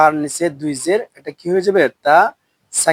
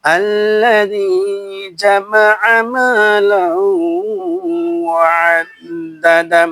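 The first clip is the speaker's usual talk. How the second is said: teacher intoning the verse for the class; Quran recitation; 3 semitones higher, much slower than usual. Shown as a demonstration. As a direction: draw every vowel out in a long reciting tune. Chants the verse again.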